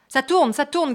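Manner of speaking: loud high yell to the crew; excited shout. A narrator's reading. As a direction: shout it out, urging the camera on. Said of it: "cria Kueso".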